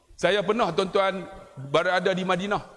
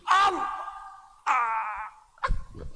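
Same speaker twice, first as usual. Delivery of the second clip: repeated takbir shout; 4 semitones higher, slower than usual. Retold or imitated. Preacher imitating a Malay worshipper's anxious repeated takbir, shouted. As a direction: shout it like someone redoing the takbir. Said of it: "Orang Melayu nak qasad".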